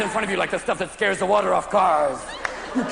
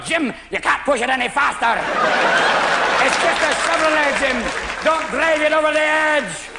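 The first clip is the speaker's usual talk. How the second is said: Scotty; 5 semitones higher than usual. Acted. Engineer warns stoned driver, urgent mock Scottish accent. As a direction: plead in a raised Scottish accent. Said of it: "Scotty's sitting next to you going".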